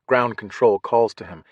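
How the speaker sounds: ordinary delivery, nothing marked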